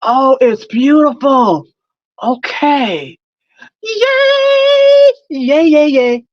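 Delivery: high, loud sing-song cheering; giddy excitement. Real, not acted. Admiring her finished helmet, loud high cheering. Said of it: "Can you tell I'm excited?".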